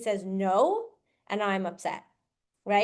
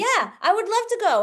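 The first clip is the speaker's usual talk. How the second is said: higher, louder eager agreement; eager yes; 9 semitones higher, 5 dB louder than usual. Retold or imitated. Husband happily agreeing to a night out with his wife, eager and raised.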